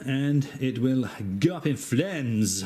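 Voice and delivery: slow, mock French accent. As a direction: put on a hammed-up French accent, drawn out. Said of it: "say that with a French accent".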